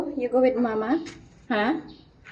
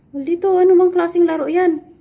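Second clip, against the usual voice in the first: loud, raised telling-off of the pet; scolding the cat; 5 semitones higher, 11 dB louder than usual. Real, not acted. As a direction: call the rough-playing cat to order, loud.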